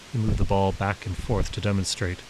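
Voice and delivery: ordinary delivery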